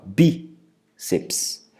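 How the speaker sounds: BÍ-ceps, mais baixo, bí marcado; sílaba tônica. Shown as a demonstration. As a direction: demonstre a penúltima tônica. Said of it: "recai na penúltima sílaba". (bíceps com ênfase no bí)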